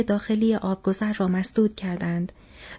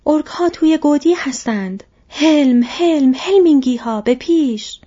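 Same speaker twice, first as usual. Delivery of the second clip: high, loud battle alarm; rallying shout; 7 semitones higher, 9 dB louder than usual. A narrator's reading. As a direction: shout the troops forward with full force. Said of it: "فریاد زد". (فریاد بلند فرمان حمله)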